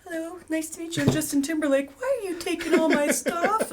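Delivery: high, sweet, naive mock voice with a laugh. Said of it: "[name]'s like".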